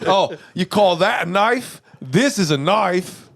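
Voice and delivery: slow Crocodile Dundee Aussie; drawn-out Aussie knife line; trying again at Australian, slow Crocodile Dundee voice; Australian accent. Pretend. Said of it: "Let me see if I can do better".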